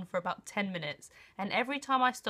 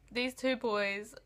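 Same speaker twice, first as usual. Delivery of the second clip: ordinary talk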